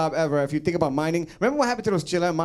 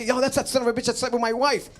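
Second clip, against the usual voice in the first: higher mock outrage at the wife's lover; mock-indignant driver, pitched up; angry train driver; 7 semitones higher than usual. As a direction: spot the guy and play it hammed-up.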